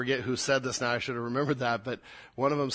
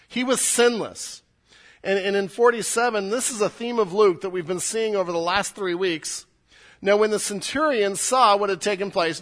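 louder, higher, driving sermon voice; preaching at full force; 6 semitones higher, 7 dB louder than usual. Acted. Pastor pressing the centurion's story on the village, full preaching voice. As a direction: preach it hard to the room.

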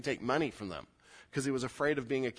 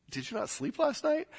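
much higher-pitched puzzled question; acted incredulous query, pitched way up; mock bewilderment; 14 semitones higher than usual. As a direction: ask it as if baffled by odd talk. Reacting to someone talking oddly.

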